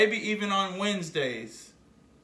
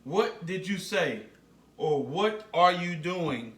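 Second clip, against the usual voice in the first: ordinary talk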